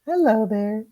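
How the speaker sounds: high, sing-song pet talk; talking to a dog. Real, not acted. Greeting the dog in studio.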